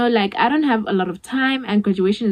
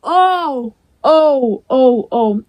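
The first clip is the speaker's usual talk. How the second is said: dismayed oh; 5 dB louder, much slower than usual. Retold or imitated. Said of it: "I'm like".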